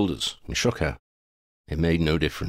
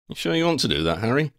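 quipping; 8 semitones higher, faster than usual. Acted. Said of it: "Ron quipped".

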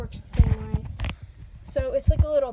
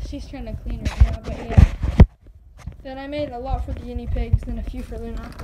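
normal talking voice

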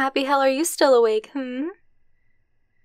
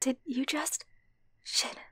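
hushed, stunned disbelief; a stunned hush as a partner says I love you back; 13 dB quieter than usual. Acted. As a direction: barely breathe it out in shock.

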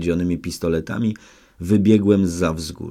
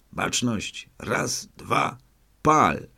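firing command; 5 semitones higher, much slower than usual. A narrator's reading.

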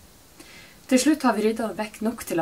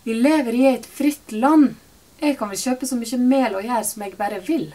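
barely held-back shout; 5 semitones higher, 8 dB louder than usual. A narrator's reading. Mother to the shop employee, seething and nearly shouting. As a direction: push each word out, fighting not to yell. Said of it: "gjør alt hun kan for å ikke rope".

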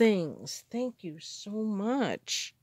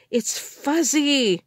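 delighted; 6 semitones higher, 7 dB louder, slower than usual. Real, not acted. Delighted over fuzzy fabric.

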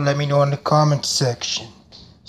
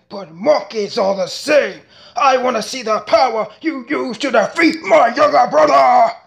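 rapid-fire anime character voices; switching impression voices. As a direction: jump from villain to villain. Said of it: "if I do well, let me know".

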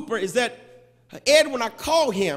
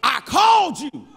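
rebuking; 5 semitones higher, 5 dB louder than usual. Acted. Martha rebukes late Jesus.

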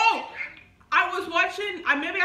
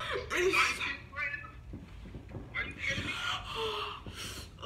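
sharp gasp breaking into giggles; gasp then laughing; 5 dB quieter than usual. Real, not acted. Prank phone call reaction.

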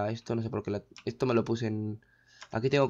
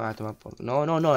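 ordinary talk, nothing marked

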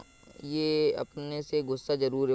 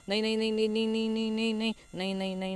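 rapid-fire chant at a higher pitch; panicked pleading; 5 semitones higher, faster than usual. Real, not acted. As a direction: rattle the word off in rising panic. Panicking as danger closes in. (घबराहट में ऊँचे सुर की रट)

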